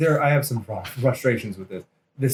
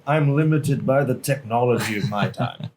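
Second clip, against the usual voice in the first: Howard Stark quote. Retold or imitated. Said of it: "it's Howard Stark syndrome".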